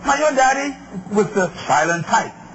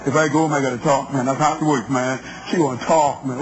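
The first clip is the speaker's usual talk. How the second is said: lower, quicker voice of a guy at the bar; buddy at the bar; 9 semitones lower than usual. Retold or imitated. Man at the bar complaining to his buddies, lower and quick. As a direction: drop low and rattle it off like a man hiding in the club.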